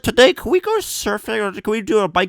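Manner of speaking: high, loud mock-eager pestering; imagined pestering companion. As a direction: play an over-keen travel buddy, loudly. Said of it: "no one who's like".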